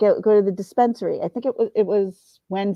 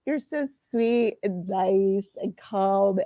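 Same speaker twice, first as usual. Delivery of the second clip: mock sweet praise; 4 dB quieter, much slower than usual. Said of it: "everyone's like".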